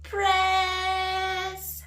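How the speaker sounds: high, drawn-out call; long cheery call-out at normal volume; playful shout. Shown as a demonstration. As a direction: sing out the word, stretched long. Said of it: "we have to shout".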